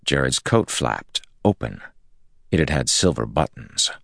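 usual speaking voice

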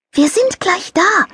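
high, light angel voice; little angel voice. A narrator's reading. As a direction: play a cheerful little angel. Angel character voice.

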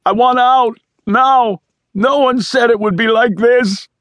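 loud, very high panicked scream; screamed outburst. A narrator's reading. Man rips off headphones demanding out, screaming. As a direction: scream it in full desperation. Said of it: "ripped off the headphones and screamed".

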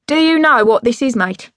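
loud, high-pitched opening, dropping on mate; drunk dad challenging. Acted. Dad waving his cassette at Rock Perry, challenging. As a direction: put it to him like a big reveal.

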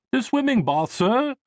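put-on high-pitched, deferential English accent. A narrator's reading. Elevator operator answering a passenger, polite English accent. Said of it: "he replied in an English accent".

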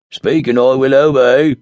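loud odd dwarf voice; a dwarf insisting on serving. Acted. Dwarf repeating the ring's formula, loud.

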